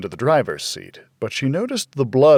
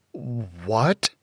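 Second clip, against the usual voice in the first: stammered; 4 dB quieter than usual. A narrator's reading. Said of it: "she stammered".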